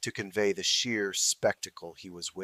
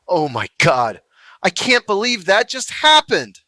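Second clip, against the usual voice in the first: loud, high relieved exclamation; giddy disbelief; 9 semitones higher, 11 dB louder than usual. A narrator's reading. Alex to his roommate after the encounter, loud giddy disbelief. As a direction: let the fear spill out as excitement. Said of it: "Alex was crying with laughter".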